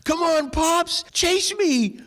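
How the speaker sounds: put-on very high, slow, pleading little boy; little grandson's voice. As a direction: beg like a four-year-old wanting to play. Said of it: "he goes".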